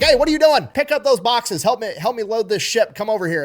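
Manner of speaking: retold shouting that fades toward the end; yelling boss. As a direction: yell like a dockside foreman. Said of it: "somebody just starts yelling at him like".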